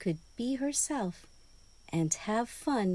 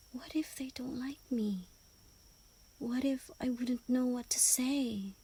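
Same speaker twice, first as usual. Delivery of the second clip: higher, fast anxious whisper; worried hedgehog thoughts; 4 semitones higher, 5 dB quieter than usual. Acted. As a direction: fret under your breath like a scared little hedgehog.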